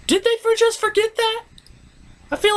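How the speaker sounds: squeaky disbelieving question; incredulous high pitch. Real, not acted. About the background ponies, in high-pitched disbelief. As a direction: yelp it in total disbelief.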